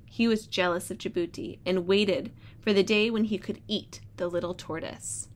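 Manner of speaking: storybook read-aloud voice; reading to children. Acted. Teacher reads story aloud.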